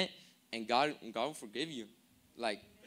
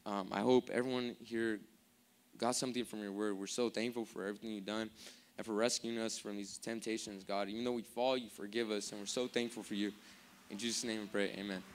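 lower, softer prayer of thanks; closing prayer; 3 semitones lower, 4 dB quieter than usual. Real, not acted. Preacher thanking God to close the message, quiet prayer. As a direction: pray quietly and humbly to God.

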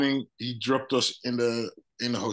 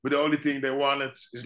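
usual speaking voice

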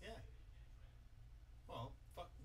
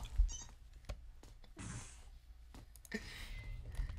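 breathy laughter; 6 dB quieter than usual. Real, not acted. Laughs after the kill.

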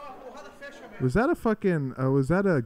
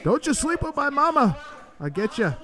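voicing the fighter; 11 semitones higher than usual. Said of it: "That's what he's saying".

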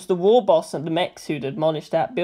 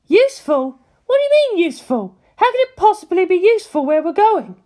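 much higher, louder incredulous woman; exasperated Misato; 13 semitones higher, 5 dB louder than usual. A narrator's reading. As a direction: scoff at the word useful.

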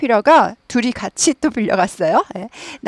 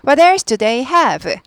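very slow, end drops; "What else do they have?" falling to the close; slow wh-question, falling end; falling tune; 3 semitones higher, 3 dB louder, much slower than usual. Shown as a demonstration. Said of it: "연습해 볼게요".

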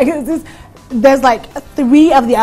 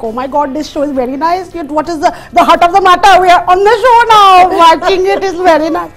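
put-on rising Indian accent, building louder and higher; 6 semitones higher, 6 dB louder than usual. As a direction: play an excited Indian auntie praising the show. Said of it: "Missus Patel... from India".

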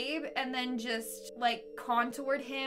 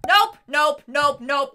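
loud disgusted nopes; 3 semitones higher, 14 dB louder, slower than usual. Real, not acted. Disgust at centipede photo.

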